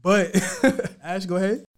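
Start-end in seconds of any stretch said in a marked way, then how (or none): 0.4-1.0 laugh. Real, not acted. Host laughing at own tease.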